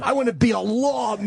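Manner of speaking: mocking a dummy. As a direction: play a dim wannabe cop, shouting.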